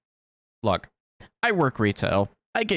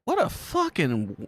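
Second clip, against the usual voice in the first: usual speaking voice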